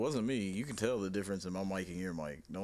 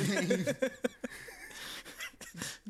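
high wheezing laugh. Real, not acted. Laughing hard.

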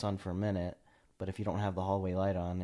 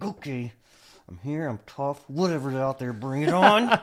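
mock tough bravado; 6 semitones higher, 10 dB louder than usual. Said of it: "be like".

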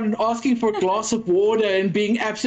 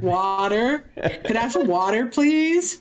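put-on American Valley girl accent; 3 semitones higher than usual. Customer asking for water, exaggerated Valley girl accent. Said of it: "that Valley girl you do is awesome".

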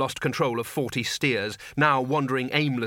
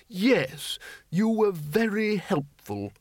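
high, slow put-on elder; high-pitched courteous old man; mild old wizard; 8 semitones higher, much slower than usual. Undead wizard thanking sergeant, mild.

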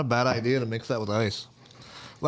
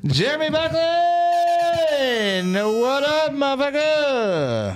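high, slow, drawn-out announcer call; hype announcer; 4 dB louder, much slower than usual. Acted. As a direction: stretch the name out like a ring announcer. Greeting a viewer on stream.

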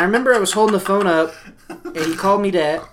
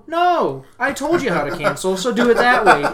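louder, higher, emphatic retold refusal; retold firm refusal; 8 semitones higher, 5 dB louder than usual. Gym worker retelling his refusal to a caller, loud and firm. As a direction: put your foot down, raising your voice.

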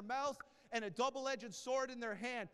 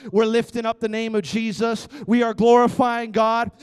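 louder, declaring at the top of their lungs; loud praise; 16 dB louder than usual. Retold or imitated. Paul and Silas praising in prison at the top of their lungs. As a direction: shout praise to God unashamed. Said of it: "It was the top of their lungs".

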